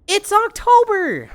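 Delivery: high, loud outraged cry; indignant exclaim. Real, not acted. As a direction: insist it's obviously October. Protesting to chat about the outfit.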